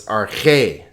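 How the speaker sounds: "arche" stressed on its final syllable, said slowly; "arche" as ar-CHE; stress on second syllable. Shown as a demonstration. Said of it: "the accent is over this syllable".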